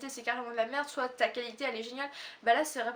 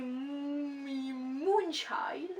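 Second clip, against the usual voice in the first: English accent; much slower than usual. Real, not acted. French vlogger reading a T-shirt print aloud, English accent. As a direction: stretch out Moonchild in an English accent. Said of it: "Mon super accent anglais revient".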